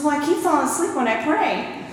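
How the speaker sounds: a hint of a worried old grandma; fretful grandma. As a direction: let her sound sheepish about dozing off. Grandma quoted on the phone.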